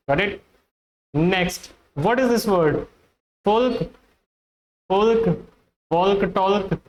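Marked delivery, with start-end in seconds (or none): 3.4-6.8 "folk" as FOLK with the L heard, "talk" as TALK with L; sounded L. Shown as a demonstration. Teacher poses the word before correcting it, L sounded. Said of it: "the L is silent".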